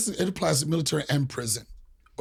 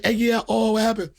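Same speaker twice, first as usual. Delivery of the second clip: her way of talking; 6 semitones higher than usual. Retold or imitated. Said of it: "That's how the bitch talk".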